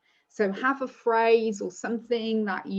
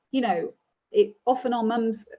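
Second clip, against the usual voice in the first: usual speaking voice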